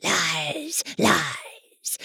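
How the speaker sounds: rough, indistinct mocking voice; hoarse voice. Hidden goblin taunts the nurse in a hoarse voice. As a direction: croak it from the dark. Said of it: "a hoarse, indistinct voice".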